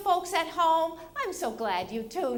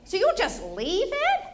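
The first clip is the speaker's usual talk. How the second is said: high, incredulous question; mock disbelief; 6 semitones higher, 3 dB louder than usual. Wife reacts to husband's one-bag method, incredulous. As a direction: challenge him with rising disbelief.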